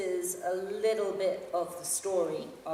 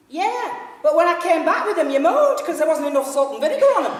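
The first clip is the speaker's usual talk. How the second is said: much higher and louder, laughing Yorkshire tale; 10 semitones higher, 16 dB louder than usual. Acted. Yorkshire fan complains about the salt and vinegar, loud and laughing. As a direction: crow it to the carriage with a laugh. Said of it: "in such a loud voice".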